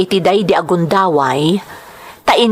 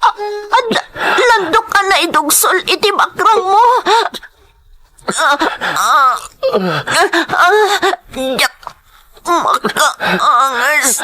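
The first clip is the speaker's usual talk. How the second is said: higher, broken gasps and cries; moaning in pain; 4 semitones higher, slower than usual. Acted. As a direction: gasp at the sight of blood. Bleeding woman moans in pain.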